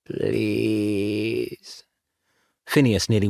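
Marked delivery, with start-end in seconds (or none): 0.1-1.8 ghostly plea. Acted. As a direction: beg from beyond the grave. Ghost pleading at the door.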